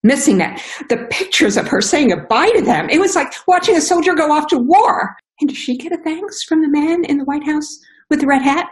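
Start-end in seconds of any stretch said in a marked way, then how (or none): 2.9-8.7 higher, impassioned protest about the man in the red hat; rising indignation. Real, not acted. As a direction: pitch climbs higher as anger builds.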